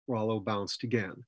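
ordinary delivery

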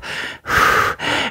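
long unvoiced sigh; tired engine's sigh; weary sigh. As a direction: sigh as if the load is heavy. Thomas sighs under load.

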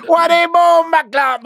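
high, loud, slow Jamaican patois snarl. Retold or imitated. Gang member mocks him, Jamaican patois. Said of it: "So he goes".

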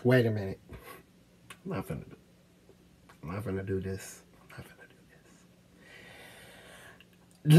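barely getting words out past the lump; real tears welling up; choked up. Real, not acted. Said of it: "I'm finna get fucking emotional".

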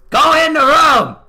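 frustrated player yelling at his character; real shout. Real, not acted. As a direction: bellow the order at the screen.

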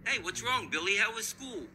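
normal talking voice

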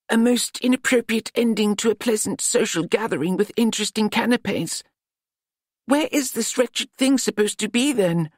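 a high, pompous older gentleman, lightly voiced; peeved gentleman fussing over the mess; wizard character voice. A narrator's reading. Wizard speaks in voiced story.

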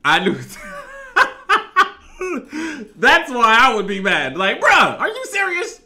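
loud, high laughing outburst; laughing hard. Real, not acted. Reactor cracking up at the porn bill story, shouting through laughter.

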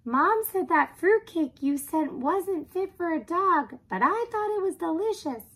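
high, loud, oblivious blurting; chatty talking dog. Acted. Dog blurts out embarrassing comments, chatty and high. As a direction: prattle brightly as the high-voiced dog. Martha's chatter, voiced by narrator.

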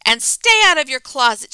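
loud, firm order; loud parting order. Acted. Mother shouting a last order, loud. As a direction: shout it as the door closes. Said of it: "she added".

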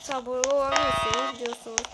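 ordinary talk

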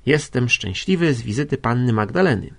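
usual speaking voice

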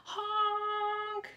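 high, stretched honk; honking horn. A narrator's reading. Dump truck honks to clear the road. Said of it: "yelled the dump truck".

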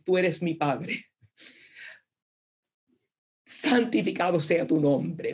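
sincere prayer. Shown as a demonstration. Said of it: "pero es un patrón".

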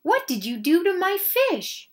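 high, loud outrage easing at the end; angry shout. A narrator's reading. Mister Rogers confronts his maid about his fish, shouting. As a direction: start at a shout, then demand an answer. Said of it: "he shouted".

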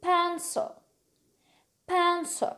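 PENCIL as 'pen-so', puffed p; correct pronunciation. Shown as a demonstration. Teacher corrects the mistake, PENCIL as 'pen-so'. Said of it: "مش بنسل".

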